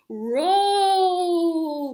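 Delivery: loud, high, rolling cry; rolling sound cry. Shown as a demonstration. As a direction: roll the word out like a tumbling ball.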